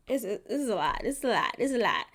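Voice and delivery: weary, drained repetition; worn out and overwhelmed; tired. Real, not acted. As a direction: let it sag out of you. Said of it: "I'm just tired".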